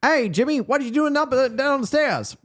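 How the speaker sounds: put-on very high, old-movie character voice; nineteen-fifties actor. Co-host mimics a fifties actor calling Jimmy, high old-movie voice. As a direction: call out like a hammed-up fifties film actor. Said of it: "like a nineteen fifties actor".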